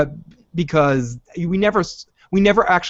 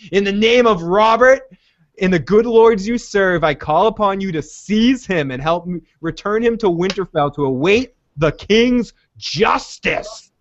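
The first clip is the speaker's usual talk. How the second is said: crazy intense; 5 semitones higher than usual. Retold or imitated. Calling on sworn knights to seize him, hammed-up crazy intensity. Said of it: "her voice goes crazy".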